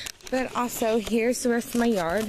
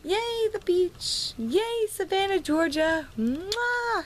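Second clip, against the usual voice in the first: much higher, slow, sing-song yay; higher, stretched out squeal of joy with a kiss at the end; gushing excitement; 10 semitones higher, slower than usual. Real, not acted. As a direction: swoon over the trip to Savannah. Gushing about the beach trip.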